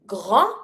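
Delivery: grand ending on a nasal vowel; French "grand" as grahn, no D; silent final D. Shown as a demonstration. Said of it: "don't pronounce the D".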